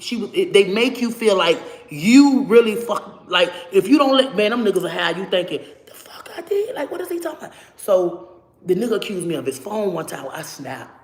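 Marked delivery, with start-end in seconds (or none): 5.9-7.5 baffled. Retold or imitated. Confused reaction to accusation.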